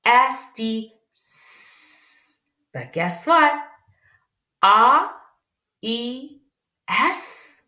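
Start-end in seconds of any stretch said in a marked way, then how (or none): 0.0-2.5 S dit S drawn out very slowly; slow letter sound. Shown as a demonstration. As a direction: hold each S sound long. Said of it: "Ça, c'est la lettre S".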